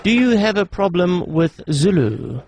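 deliberate recorded-operator question; automated phone menu. Pretend. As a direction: question the caller in an even menu voice.

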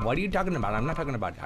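usual speaking voice

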